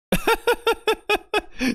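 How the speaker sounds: high, breaking laughter; high cackle; real laugh. Real, not acted. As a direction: burst out laughing. Streamer laughs at game line.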